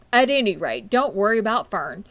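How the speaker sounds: calm her worries kindly; reassuring father. A narrator's reading. Father reassuring his worried wife, kindly.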